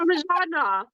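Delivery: exaggerated Italian pronunciation; Italian accent. Shown as a demonstration. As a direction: Parmigiana rolled out the Italian way. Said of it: "just with an Italian accent".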